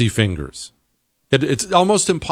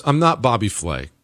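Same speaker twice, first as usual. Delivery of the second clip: usual speaking voice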